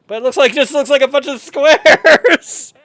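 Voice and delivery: loud, high, cracking up; laughing disbelief. Real, not acted. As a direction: scoff at it through laughter. Mocking painting while laughing.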